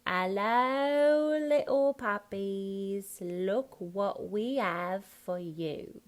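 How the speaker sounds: slow, drawn-out Cockney coaxing; Cockney accent. Shown as a demonstration. Coach voicing Horace to little puppies, Cockney accent. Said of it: "No beginning H's".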